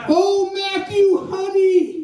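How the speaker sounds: high, slow, sing-song mother call. Retold or imitated. Mother calls her son across the airport, high and slow. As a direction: croon his name like a doting mom.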